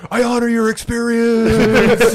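high, slow, mock-earnest voice; slow and hammy, as if deeply moved; mock solemn. Mocking a friend with a slow, high, earnest voice.

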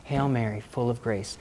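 soft, low murmured prayer; said inwardly. Retold or imitated. Choked man praying in his head, hushed. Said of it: "So I said in my head".

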